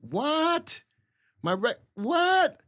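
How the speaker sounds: high, startled what; real startled double take; shocked disbelief. Real, not acted. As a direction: let the pitch jump up in disbelief. Reacting to a viewer's comment.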